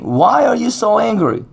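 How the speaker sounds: God's question lifted high; high, earnest divine question; pleading high question. Acted. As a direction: raise the pitch and ask it searchingly. God's question to Cain, preached.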